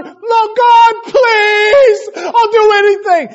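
hammed-up wailing shout, very high. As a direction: plead at full volume, stretching the words. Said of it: "Do I have to say it louder?".

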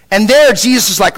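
normal talking voice

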